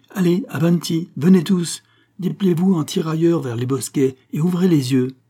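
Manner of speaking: high, loud commands to the crew; shouted orders. Acted. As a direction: shout them into a search line. Ordering search line.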